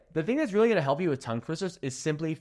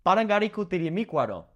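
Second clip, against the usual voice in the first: quick, clipped run of Parangaricutirimícuaro; rapid tongue twister; fast; 4 semitones higher, faster than usual. Shown as a demonstration. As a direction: say Parangaricutirimícuaro at full speed. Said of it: "Say that ten times fast".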